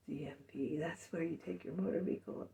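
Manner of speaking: breathy whispered joke to herself; whispered aside. Real, not acted. Muttering a joke aside about the DMV, in a whisper. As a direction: whisper the quip under your breath.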